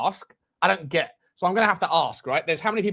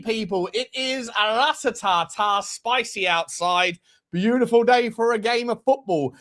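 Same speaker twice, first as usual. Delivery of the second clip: ordinary talk, nothing marked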